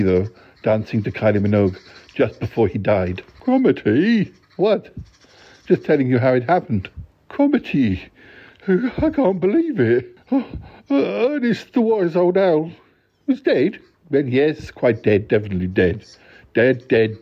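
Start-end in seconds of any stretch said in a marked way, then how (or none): 3.4-10.5 shocked outcry. Acted. Listener shocked the owl died. 10.9-13.8 aghast disbelief. Acted. Listener aghast at owl's death.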